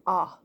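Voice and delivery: "ah" as the lone vowel of dog; short O sound. Shown as a demonstration. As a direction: isolate "ah" as a short O. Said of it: "that has a short O sound".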